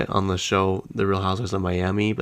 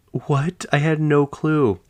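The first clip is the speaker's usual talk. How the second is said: retold shock, higher and slower; stunned surprise; 7 semitones higher, slower than usual. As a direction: act out hearing awful news for the first time. Retold shock at news of death.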